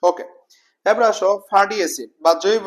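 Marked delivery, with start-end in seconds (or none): none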